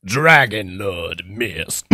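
low, slow dragon lord voice; Dragon Lord Mist. Shown as a demonstration. Voice actor tries his live villain voice for friends, low and slow. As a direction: intone it deep and drawn out. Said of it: "try and do the live one".

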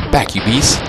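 shouting. Real, not acted. Fending off a monster.

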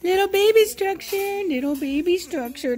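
sing-song coo to the little puppy. Real, not acted. Owner cooing at the puppy Giovanni in high baby talk.

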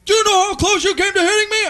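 high, loud outraged cop impression; exaggerated mock-cop shout. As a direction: yell it up high like an irate officer. Cop retold jumping out, quarrelling.

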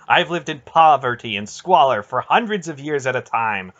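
loud, mounting impassioned rebuke; intense. A narrator's reading. Aunt telling Garion all she has endured, intense. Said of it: "the intensity of Aunt Paul's words".

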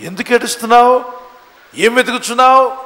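high, loud preacher's questioning; feigned unknowing question. Acted. Jesus questioning the weeping woman as if unknowing, loud. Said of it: "ఎరగనట్టే అడుగుతాడు మన ఏసయ్య".

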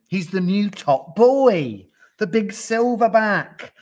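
high, loud, stretched-out boasting; excited hype. A narrator's reading. Gnu hypes the new silverback, excited. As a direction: build him up grandly.

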